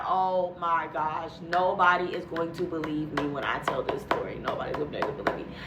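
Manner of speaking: low, soft, despairing words to herself; hopeless mutter. Retold or imitated. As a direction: sink into quiet disbelief.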